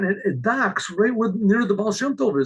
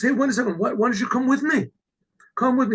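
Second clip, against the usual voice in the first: higher-pitched, eager invitation; eager urging; 6 semitones higher, faster than usual. Retold or imitated. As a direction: urge him to come along, voice lifted. Urging the old man to come.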